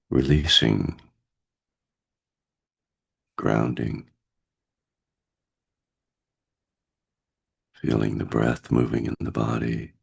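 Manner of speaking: guided meditation. Acted. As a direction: gently guide them into stillness.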